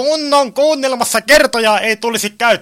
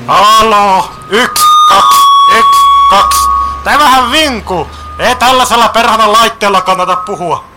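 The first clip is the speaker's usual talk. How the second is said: raised voice into a walkie-talkie; loud radio test; 7 dB louder than usual. Acted. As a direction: test the radio loudly, then complain. Testing a squealing walkie-talkie. (kova radiopuhelimen testaus)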